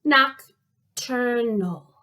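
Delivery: "Nocturnal" stretched into very slow separate beats; drawn-out count of the syllables in "Nocturnal". Shown as a demonstration. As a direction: sound out "Nocturnal" one syllable at a time. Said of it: "How many syllables are in nocturnal".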